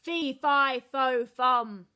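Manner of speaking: loud booming giant roar. A narrator's reading. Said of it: "roared the giant".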